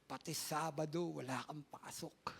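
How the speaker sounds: hushed tender voice of God. Acted. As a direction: say it gently like a blessing. Voicing Lord, soft and slow.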